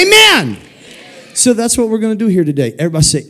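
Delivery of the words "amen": much higher, ringing amen; sing-song preacher call. Acted. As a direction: lift it way up for the crowd. Congregation call and response.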